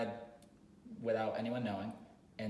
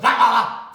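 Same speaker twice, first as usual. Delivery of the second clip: sudden very loud mock yelp; imitated dog bark-shout; 12 semitones higher, 21 dB louder than usual. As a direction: burst out like a dog lunging. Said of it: "looks at your neighbor and goes".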